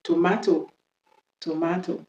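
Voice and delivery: British "tomato", to-MAH-to; British accent. Shown as a demonstration. Teacher introducing the British version, British accent. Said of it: "on va faire l'accent british".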